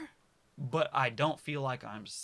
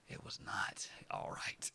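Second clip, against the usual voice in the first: breathy unvoiced aside; whisper; 8 dB quieter than usual. Imagining telling the viewer later, whispered. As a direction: whisper it like a secret. Mock future self, whispered.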